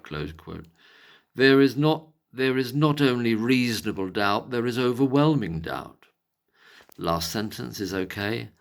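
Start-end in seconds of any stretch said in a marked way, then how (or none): 1.3-6.1 loud, emphatic posh English declaiming. Acted. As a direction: declaim the quote in a posh accent. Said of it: "I'll do it in a posh voice".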